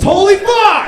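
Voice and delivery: high, loud, laughing shriek of disbelief; huge panicked outburst; shocked yell. Real, not acted. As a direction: scream it at the crowd. Comic reaction to HIV hint.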